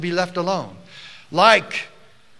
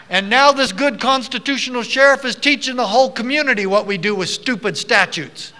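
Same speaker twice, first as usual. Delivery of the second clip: loud, high, proud oratory; rousing speech; 7 semitones higher, 9 dB louder than usual. Real, not acted. Speaker praising the constitutional sheriff to the crowd, in rousing oratory. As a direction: project it to the whole hall.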